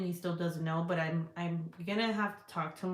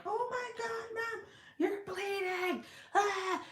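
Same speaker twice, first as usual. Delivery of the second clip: put-on panicked little boy; 14 semitones higher, slower than usual. Panicked son voiced in story.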